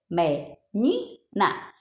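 "Menina" said very slowly, each syllable me-ni-na separated; syllables spelled out. Shown as a demonstration. Teacher shows pupils the syllables of "Menina", slowly. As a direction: break "Menina" into three slow beats. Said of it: "abro a minha boquinha três vezes".

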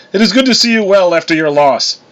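high, courtly god voice; formal condolence. A narrator's reading. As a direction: offer ceremonious sympathy to a lady. Thor formally offers condolence.

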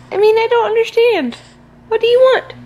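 tired mother asking what's wrong. Real, not acted. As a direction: pitch rising in weary pleading. Frustrated mother to baby.

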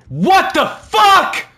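high, slow furious yell; sudden loud outburst. Real, not acted. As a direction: burst out in shock.

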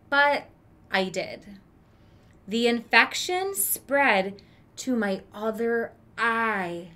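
gentle read-aloud storytelling voice; story narration. A narrator's reading. Teacher reading a picture book to kids, storytelling voice. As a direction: read it like a children's book to the class.

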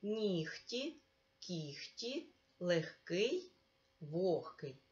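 Нігті, кіхті, легкий, вогкий each said slowly with г as х; careful pronunciation. Shown as a demonstration. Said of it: "Давай правильно прочитаємо з тобою ці слова".

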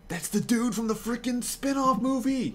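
high-pitched fanboy outburst; real, amazed excitement; excited recognition. Real, not acted. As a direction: blurt it out, voice jumping way up. Recognizes a character in the game.

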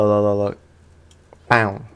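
normal talking voice

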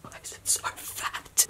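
whispered panic at the mirror; horrified whisper. Real, not acted. Shocked at swollen nose.

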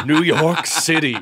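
laughing. Retold or imitated. Joking flight to America.

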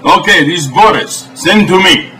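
broken-English Russian host, deadpan; mock Eastern European TV host; fake Russian accent. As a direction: play a stern Russian cook in broken English. Boris cooking show sketch.

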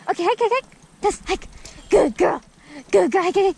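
high, loud urging and praise; excited dog commands; calling out. Real, not acted.

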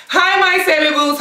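usual speaking voice

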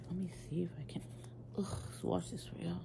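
breathy hushed whisper while swatching. Real, not acted. Beauty vlogger whispering to viewers while swatching. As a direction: whisper to the camera as you test it.

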